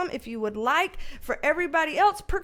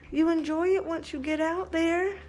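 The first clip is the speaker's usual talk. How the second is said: normal talking voice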